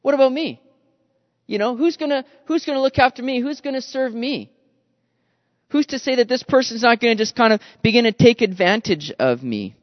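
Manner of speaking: self-pitying complaint. Retold or imitated. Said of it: "may have begun to thought at this point".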